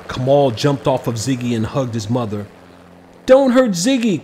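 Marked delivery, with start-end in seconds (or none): 3.2-4.2 high, loud desperate plea; pleading cry. A narrator's reading. Boy begging police to spare his dragon, crying out. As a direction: beg them to spare him. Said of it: "Kamal cried".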